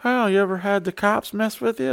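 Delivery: high curious stranger voice. Retold or imitated. Random person asking about the cops, high curious voice. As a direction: ask it like a nosy stranger. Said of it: "they'll be like".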